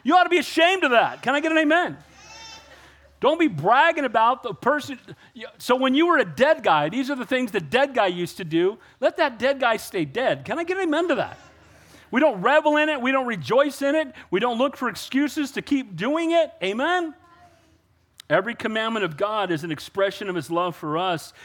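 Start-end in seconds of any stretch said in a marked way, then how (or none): 0.0-1.2 much higher, loud reproach; preacher's high loud rebuke; scolding rebuke. Retold or imitated. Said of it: "I'm like". 1.2-17.1 rising fervent sermon delivery; preaching. Acted. Preaching at full force again.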